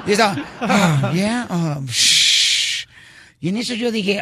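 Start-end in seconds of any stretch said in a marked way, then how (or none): 0.6-2.9 imitated exasperated huffing. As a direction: huff and puff like an annoyed customer. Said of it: "estaba bufando la señora".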